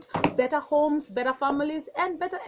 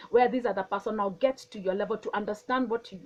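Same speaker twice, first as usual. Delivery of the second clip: ordinary talk, nothing marked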